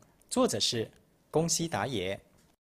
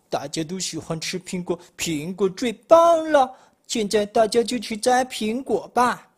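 building from surprise to loud enthusiasm; excited exclaiming; 5 semitones higher, 8 dB louder than usual. Acted. Wolf rallying friends to pick apples, calling out excitedly. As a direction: realise it, then cry out louder and higher. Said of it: "巴尔就喊了起来".

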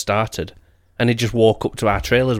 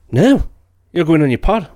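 higher, louder mock of the wife snapping back; mock snappy wife; 7 semitones higher, 6 dB louder than usual. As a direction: throw her refusal back petulantly. Imitating wife's snappy reply.

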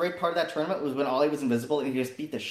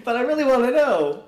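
high, louder whine rising through the line; torn pleading; 11 semitones higher, 5 dB louder than usual. Retold or imitated. Player reliving a choice between friends and truth, torn pleading. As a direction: act out an agonized, hammed-up protest. Voicing his torn inner dilemma.

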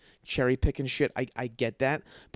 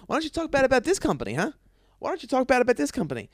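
rapid, higher-pitched goading of the interviewer; mock badgering reporter; 9 semitones higher, faster than usual. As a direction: needle her fast like a pushy reporter. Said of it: "being like".

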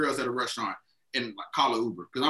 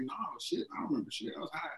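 hushed retold reply; 2 semitones higher, 13 dB quieter, faster than usual.

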